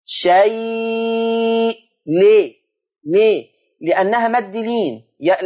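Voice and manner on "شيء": شيء with the ya stretched for four to six beats; شيء drawn out, the ya held before the hamza; long madd demo. Shown as a demonstration. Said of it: "همد الياء أربعة أو ست حركات".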